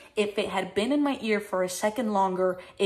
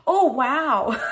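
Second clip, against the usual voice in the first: loud, higher mock amazement; put-on wow into the mic; bluffing commentary; 4 semitones higher, 6 dB louder than usual. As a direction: fake an impressed commentator reaction. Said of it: "bullshitting my way in there".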